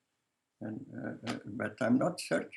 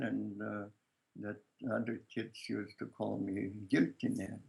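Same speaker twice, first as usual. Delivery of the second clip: ordinary talk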